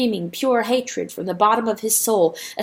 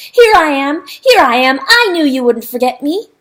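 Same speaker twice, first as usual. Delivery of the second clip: loud, higher joyful calls; calling out; 6 semitones higher, 9 dB louder than usual. Acted. Rooster hailing the boats, loud happy calls. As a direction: call out to the boats.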